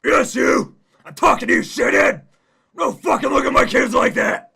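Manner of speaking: very high, very loud bellowing; loud angry yell. Acted. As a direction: scream at him across the yard. Fat man keeps yelling.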